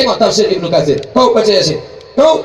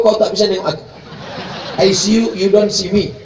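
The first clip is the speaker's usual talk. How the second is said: ordinary talk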